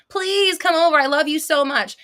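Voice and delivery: sweet mock invite; pleading invitation. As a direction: play the one begging them to come over. Mock plea acted out.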